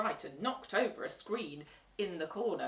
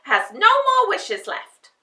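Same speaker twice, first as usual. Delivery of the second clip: loud cackling witch, slowing at the end; scheming witch; 16 dB louder than usual. A narrator's reading. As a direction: plot it like a greedy witch. Witch plotting aloud.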